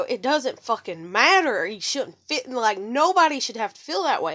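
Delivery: worked-up anger. Retold or imitated. Retelling her rant that people should not feel targeted, really mad. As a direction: lean hard on the key words, getting madder. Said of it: "And I got really mad".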